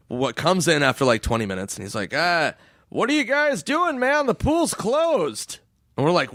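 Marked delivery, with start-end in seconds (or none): 2.1-5.6 high, slow dopey guard voice; lazy high security guard voice; mock security guard. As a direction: drawl it like a sleepy old guard.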